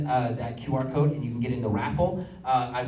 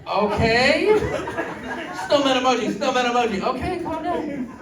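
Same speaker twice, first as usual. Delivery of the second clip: high, flustered mock voice; flustered texter; 10 semitones higher, 4 dB louder than usual. Stranger reacting to emoji spam, high and flustered. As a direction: play the overwhelmed texter, pitched up.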